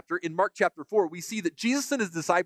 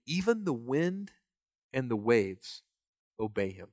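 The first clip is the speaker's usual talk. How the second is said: hushed amazement; 4 semitones lower, 4 dB quieter than usual. Retold or imitated. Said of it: "the disciples were amazed".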